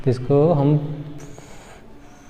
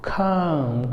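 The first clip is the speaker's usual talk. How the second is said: खाम with a breathy aspirated kh; English-style खाम, aspirating the k softly; soft British-style खाम; 8 dB quieter, much slower than usual. Shown as a demonstration. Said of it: "अंग्रेज क्या बोलेंगे?".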